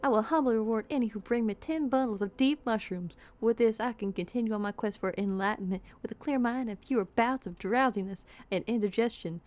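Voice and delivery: put-on dramatic Southern accent, high at first then settling. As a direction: plead grandly in a Southern accent. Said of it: "Why Southern accent?".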